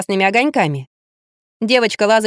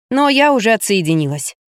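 usual speaking voice

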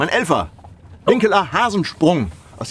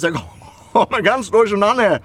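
usual speaking voice

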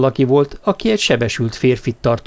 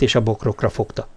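ordinary delivery, nothing marked

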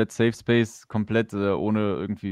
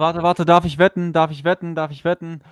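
eager high-pitched asking to bet; louder, bubbling with eagerness; excited; 5 semitones higher, 6 dB louder than usual. Real, not acted. Eager to guess the price.

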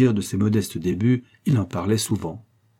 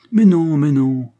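loud, drawn-out, higher-pitched objection; insistent refusal; 6 semitones higher, 8 dB louder, slower than usual. A narrator's reading. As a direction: push the offer away, slowly and loudly. Refusing the banker's insistent offer. (refus insistant, voix forte)

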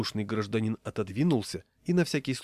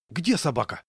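startled question, pitched up; 5 semitones higher, 3 dB louder than usual. A narrator's reading. Raincoat passenger suddenly hearing of a dog, startled. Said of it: "встрепенулся вдруг пассажир".

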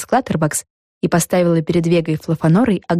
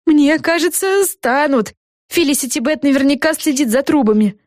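mildly flustered, higher voice; agitated; 8 semitones higher than usual. A narrator's reading. Café owner warning Vega about the pipes, mildly agitated higher voice. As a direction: worry aloud in a raised pitch. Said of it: "взволнованно проговорила миссис Клаттербакс".

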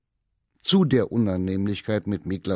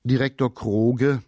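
usual speaking voice

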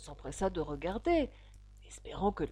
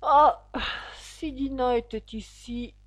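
high, loud sigh into wistful wish; wishing aloud; 5 semitones higher, 7 dB louder, slower than usual. A narrator's reading. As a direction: open on a big "ah", then wish aloud. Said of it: "dit Alice tout haut". (souhait soupiré tout haut)

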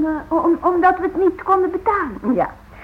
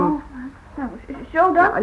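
usual speaking voice

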